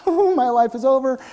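high, joking doom; mock groom's dread. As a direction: play the terrified groom for laughs.

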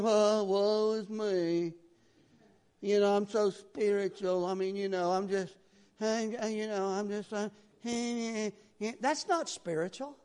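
a mild, drawn-out mopey moan; whiny self-pity. Retold or imitated. Mocking the falsely humble churchgoer, mildly whiny. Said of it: "Actually, it's kinda whiny".

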